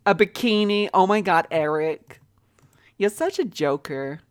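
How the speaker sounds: put-on lively American accent, lightly done. Dressing up her game employees, in an American accent. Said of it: "I promise I'm gonna stop now with the accent".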